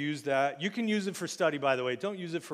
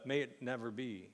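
tame, soft reading; 3 semitones lower, 8 dB quieter than usual. Retold or imitated. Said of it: "he didn't go with a".

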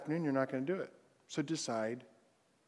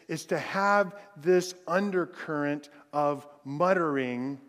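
ordinary delivery

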